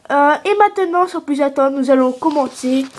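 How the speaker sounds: a kid hosting a pretend quiz show; game show host. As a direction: quiz host reading out the question. Host starts first round.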